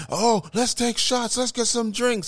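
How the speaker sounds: high-pitched, drawn-out mock excited friends; imitated giddy high voice; party girls. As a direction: squeal the invitation like eager drinking buddies. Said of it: "Here comes Barley and Whit-, and Whitney".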